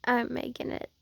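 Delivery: soft, slow, drowsy tease; sleepy teasing murmur. Acted. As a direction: murmur a lazy threat.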